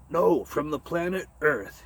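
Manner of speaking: almost shouting. Acted. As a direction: push it just short of a shout. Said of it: "he almost shouted".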